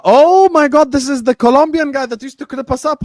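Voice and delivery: high, loud burst of amazement; real thrilled outburst; excited recognition. Real, not acted. Spotting an old follower's message.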